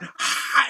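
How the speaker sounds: high, loud, rough open scream; imitated scream. Friends screaming over loud amps, loud mock scream. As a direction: mimic a drunken band screaming. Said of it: "screaming at the top of our balls".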